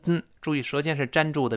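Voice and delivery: ordinary talk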